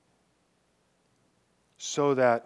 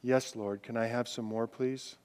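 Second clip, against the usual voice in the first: lower, much softer plea; humble quiet ask; 5 semitones lower, 8 dB quieter than usual. Pretend. Preacher voicing the worshipper's humble plea, softly. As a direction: ask God gently, almost whispered. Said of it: "The attitude of saying".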